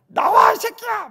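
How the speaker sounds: high, drawn-out furious shout; thuggish yell. Retold or imitated. Boy with a bat calls out the glove thief, yelling thuggishly. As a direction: yell like a gangster banging on a gate. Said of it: "뻑뻑 치면서 깡패처럼".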